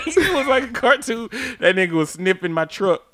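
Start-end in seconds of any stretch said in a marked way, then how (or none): 0.0-1.3 high words broken by laughter; laughing hard through the line. Real, not acted. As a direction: keep telling it while cracking up. Cracking up at own story.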